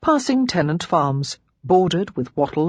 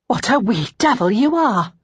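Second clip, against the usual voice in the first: higher-pitched horrified scolding; scandalised cook, pitched up; cook's shocked exclamation; 6 semitones higher, 3 dB louder than usual. A narrator's reading. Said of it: "the cook exclaimed".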